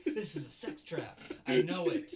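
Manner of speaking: high, loud panicked yell with a laugh; paranoid shout. Retold or imitated. Recapping anime, vice principal yelling in paranoid panic. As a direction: screech it like a paranoid man. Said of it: "he's like".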